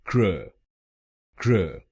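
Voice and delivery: क्र said as a short krə with a reduced vowel; first syllable alone. Shown as a demonstration. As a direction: clip क्र short, no full o. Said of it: "पहले शब्दांश को बोलिए".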